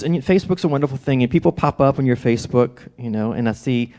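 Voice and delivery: ordinary talk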